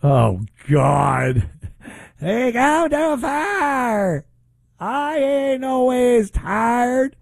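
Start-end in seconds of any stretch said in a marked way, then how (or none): 2.2-7.2 high, slow, drawn-out mocking preacher voice; mock Southern church accent. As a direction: parody the drawl with exaggerated stretching.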